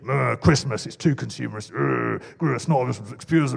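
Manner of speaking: low grumbling grump; grumpy voice. Acted. Preacher mocking Christmas pessimists to the congregation, grumpy voice. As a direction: put on a grumpy, pessimistic mumble. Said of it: "That was my grumpy voice".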